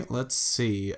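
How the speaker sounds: ordinary delivery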